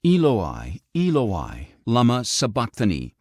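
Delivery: loud cry. A narrator's reading. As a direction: call out in anguish, restrained. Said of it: "cried out in a loud voice".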